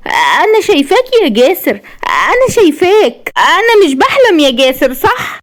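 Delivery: high, elated voice full of wonder; smiling joy. A narrator's reading. Wife sees her husband after surgery, elated. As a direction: exclaim high through a smile, disbelieving. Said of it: "وهي بتبتسم وبتقول".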